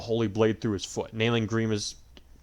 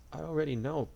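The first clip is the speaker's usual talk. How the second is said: tearful; 6 semitones higher, 4 dB quieter than usual. A narrator's reading. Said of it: "tears falling down her cheeks".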